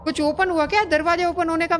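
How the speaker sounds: high-pitched startled protest; startled complaint. Real, not acted. Jump scare in horror game. (चौंककर ऊँचे सुर में शिकायत)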